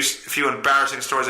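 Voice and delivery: ordinary talk, nothing marked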